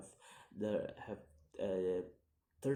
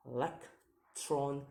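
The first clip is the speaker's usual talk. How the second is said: syllable by syllable; 2 semitones higher than usual. Shown as a demonstration. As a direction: sound out "electronic" one syllable at a time. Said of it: "count the word syllable backwards".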